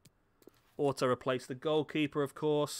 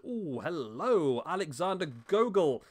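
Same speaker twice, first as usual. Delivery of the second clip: drawn-out, intrigued greeting with swooping pitch; pleasant surprise; 4 semitones higher, slower than usual. Real, not acted. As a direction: greet a lucky find with delighted surprise. Delighted find among scouted players.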